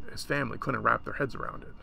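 ordinary delivery, nothing marked

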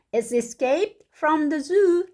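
high, slow character voice; alarmed story father. Acted. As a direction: announce it as worried storybook dad.

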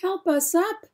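high-pitched cry of a tumbled letter; crying for help. Acted. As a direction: call up for help from the bottom of the pile. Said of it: "cried A".

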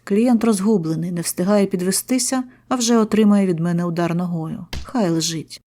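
usual speaking voice

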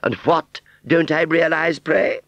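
high, affected challenge; haughty fairground aunt. Acted. Aunt Sally challenging Wurzel to explain, haughty.

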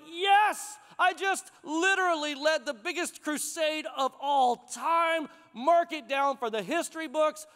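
high, exultant cheer opening into a boast; triumphant. Acted. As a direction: crow over a huge win, pitch up. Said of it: "you'd think he'd be like".

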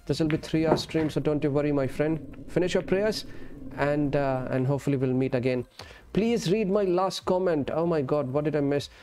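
usual speaking voice

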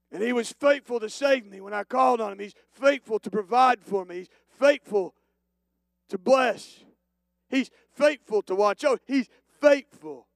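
rising pulpit chant of faithfulness; building preached litany. Real, not acted. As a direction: stack the claims rising in force.

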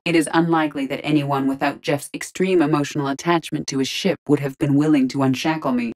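ordinary talk, nothing marked

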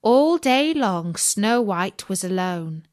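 ordinary delivery, nothing marked

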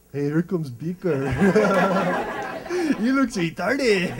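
slow, laughing taunt from a bully; hallway kids sneering at the new target; mocking schoolkids. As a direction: drag it out and snicker.

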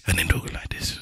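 dropped to a low whisper; mock mumbling. Shown as a demonstration. Co-host mimicking friends' poor mic levels, whispered. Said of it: "you people talking like this".

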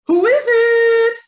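very high, loud little pig; high piggy call through the door; squeaky pig voice. A narrator's reading. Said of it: "called the second pig".

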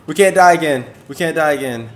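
normal talking voice

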